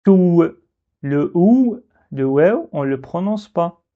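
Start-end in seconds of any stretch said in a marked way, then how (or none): 0.0-0.4 "tout" ending in an audible w, "tou-w"; loud "tout" with a w glide at the end; wrong sounded waw. Shown as a demonstration. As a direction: close the oo of "tout" into a w, cut short. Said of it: "Et on ne dit pas".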